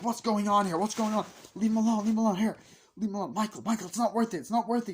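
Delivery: high, loud hammed-up pleading to stop a fight; mock frantic shouting. Friends breaking up the fight, high mock shouts. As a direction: yell like friends pulling someone out of a fight.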